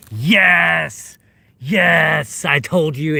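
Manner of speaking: high, loud burst of joy; triumphant yes; excited cheer. Real, not acted. Thrilled at finding a shark tooth.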